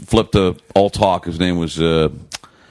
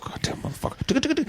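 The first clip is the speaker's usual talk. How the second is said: under-breath whispered swearing, much softer; muttered curse; 11 dB quieter than usual. Retold or imitated. Alone at 3am, cursing Bubba's text in a whisper. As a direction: hiss the curse quietly at the phone.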